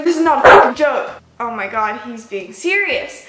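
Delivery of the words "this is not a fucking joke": loud furious snap at the cashier; retold angry yell. Customer cursing at the cashier, furious shout. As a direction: bark it like an enraged customer.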